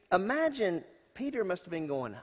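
usual speaking voice

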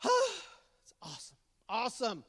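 ordinary talk, nothing marked

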